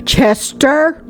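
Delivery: high sharp call of a name; hollering. A narrator's reading. Mother hollering after her son Chester. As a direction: holler after him across the yard. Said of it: "Jean hollered".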